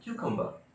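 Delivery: क्यूकम्बर ending in bah; British pronunciation. Shown as a demonstration. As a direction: drop the final r of क्यूकम्बर. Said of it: "British English follow कर रहे हैं".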